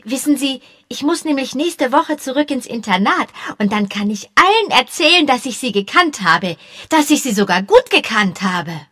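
high, eager schoolboy chatter; excited boy. A narrator's reading. Young boy chattering eagerly to the policemen. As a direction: babble on like an excited kid.

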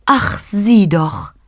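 langsam, höher und verzückt; selig. A narrator's reading. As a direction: die Mutter staunt glückselig über ihr Kind. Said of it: "sagte Maria selig". (selig und langsam, höhere Stimme)

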